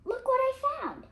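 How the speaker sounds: high, loud eager call to his sister; bright shout of discovery, pitched high; excited call. Acted. Jack shows his find.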